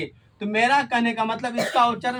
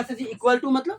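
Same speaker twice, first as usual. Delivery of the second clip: student asking; 3 semitones higher than usual. Retold or imitated. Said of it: "अब बोलेगा".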